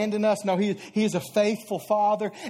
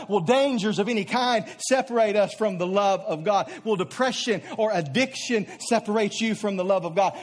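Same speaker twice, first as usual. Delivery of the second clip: ordinary talk, nothing marked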